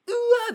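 high, loud squealed ooh ah; mock amazed audience gasp; gasped TV-panel ooh.

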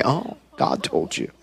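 dry disbelieving murmur; sceptical. Retold or imitated. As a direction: drop it low and doubtful, like you don't buy it. Doctor doubts prophecy.

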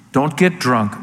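ordinary talk, nothing marked

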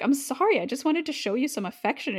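high, pouty apologetic voice; sulky boy caught out; whiny apology. Acted. Demon apologizes, acted.

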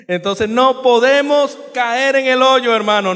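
más alto y más fuerte, predicando; predicación enérgica. Real, not acted. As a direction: clamar a la congregación. Predicando con fuerza. (predicando con fuerza)

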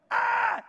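loud high "Ah!"; short yell of alarm; panicked scream. Acted. Elijah fleeing the tornado, acted-out scream.

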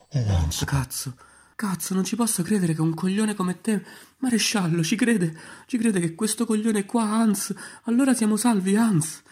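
molto più acuta e più forte; commossa e felice. Acted. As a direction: grida di gioia stridula di una vecchia zia. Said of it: "disse commossa". (zia Albicocca felice, voce acutissima e forte)

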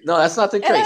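high, loud laughing no; laughing correction. Retold or imitated. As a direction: correct him while cracking up. Retelling friend's correction, laughing.

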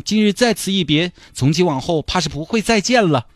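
high, hammed-up sentimental goodbye to the dolphin; tearful farewell. Mr Chen bidding the released dolphin farewell, hammed-up sentimental. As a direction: send the dolphin off like your own child, overplayed.

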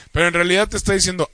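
ordinary delivery

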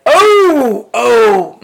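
high, loud drawn-out oohs; stretched, high mock "oh"; mock scandalized reaction. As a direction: imitate someone calling out a burn. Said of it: "Kayla will be like".

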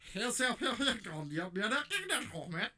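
high, loud garbled gibberish; alien dog voice. Retold or imitated. Dog on the street speaking alien, loud high gibberish. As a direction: speak strange nonsense as a talking dog. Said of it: "dog just hops up on your bed and just goes".

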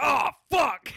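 high, loud yelp of pain; imitating him hitting the ceiling light; mock pained yell. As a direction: yell it like you hit your head. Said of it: "He's like".

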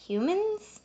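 HUMANS? rising high in confusion; confused question. Acted. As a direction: ask Humans? with a sharp high rise.

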